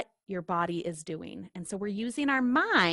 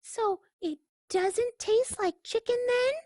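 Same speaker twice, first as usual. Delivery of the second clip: much higher duckling voice; innocent duckling; 14 semitones higher than usual. Acted. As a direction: keep poking with a sweet question.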